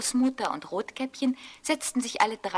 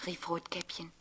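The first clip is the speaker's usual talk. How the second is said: plain reading voice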